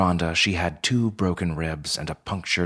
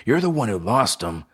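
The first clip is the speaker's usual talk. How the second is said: restrained, higher-pitched bellow; 5 semitones higher, 4 dB louder, faster than usual. A narrator's reading. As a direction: throw the blame back, voice a little raised. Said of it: "bellowed Joe".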